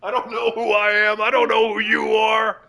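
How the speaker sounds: loud mock panic. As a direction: yell in hammy confusion. On-air panic.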